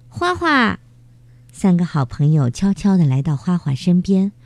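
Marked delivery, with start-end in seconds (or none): 0.1-0.8 calling out. Acted. Friends calling Huahua.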